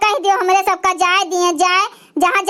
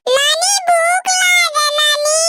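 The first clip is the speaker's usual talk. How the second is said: put-on higher, slow small child's voice; hungry little child; 8 semitones higher, slower than usual. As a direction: whine for food like a toddler. Hungry child calls grandmother.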